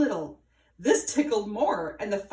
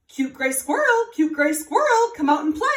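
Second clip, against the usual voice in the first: high, loud calling out; 7 semitones higher, 7 dB louder than usual. A narrator's reading. Mouse calls up the tree to his friend, loud and high. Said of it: "looked up and called".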